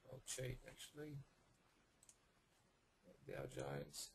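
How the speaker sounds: hoarse, losing his voice; husky voice. Real, not acted. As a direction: talk through a husky, tired throat. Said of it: "I'm a bit husky, I'm losing my voice".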